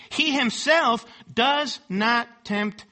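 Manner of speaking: HE HIMSELF high and slow, each word weighted; emphatic. Shown as a demonstration. Preacher quoting James on temptation, HE HIMSELF slow and emphatic. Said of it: "it's emphatic".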